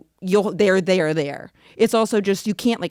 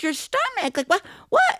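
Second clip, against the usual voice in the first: much higher, baffled mom; mom's shocked voice; 13 semitones higher than usual. Retold or imitated. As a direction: imitate mom's horrified confusion. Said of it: "And she goes".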